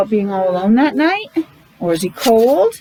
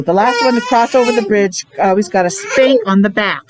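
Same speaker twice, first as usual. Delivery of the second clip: ordinary delivery, nothing marked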